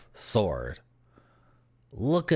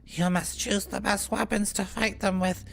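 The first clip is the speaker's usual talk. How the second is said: higher, even narrating NPC voice; 13 semitones higher than usual. Acted. NPC describing his wares, higher storytelling voice. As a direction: read it as a proud local craftsman.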